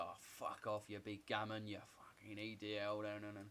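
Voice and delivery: lightly voiced scoffing heckler; mock dismissal. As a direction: toss off the insults as a mocking imitation. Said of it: "it's like".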